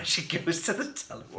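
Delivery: giggling through the words; laughing. Real, not acted. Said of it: "Why is that funny?".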